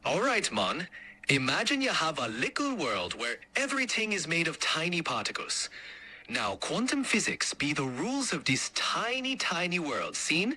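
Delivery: put-on light Jamaican accent, relaxed lilt. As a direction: explain it like a laid-back Jamaican. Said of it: "in a Jamaican accent".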